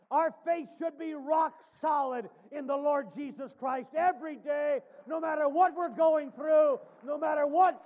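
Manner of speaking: loud, rising preacher's shout; preaching shout. Acted. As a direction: drive the point home, shouting. Climax of sermon point.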